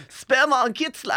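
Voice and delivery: high, slow mock-grand title read; mock title announcement. Friend repeating the porn title in a slow, high mock-grand voice.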